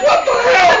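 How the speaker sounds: very high, loud yell through laughter; mock shocked yell. Storyteller acts stunned attacker, laughing high yell. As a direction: yell it in hammed-up disbelief.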